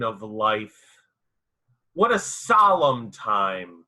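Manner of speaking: slow, declaimed verse with long pauses; solemn dramatic poetry reading, phrase by phrase; poem recitation. Acted. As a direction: recite it grandly, pitch swelling high on calls and questions. I'm going to be reciting for you the poem.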